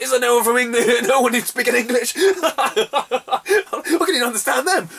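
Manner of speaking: a high, loud, incredulous yell; high exasperated outcry. Retold or imitated. Tourist lost in London, yelling in disbelief. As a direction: pitch shot up, words thrown out in disbelief.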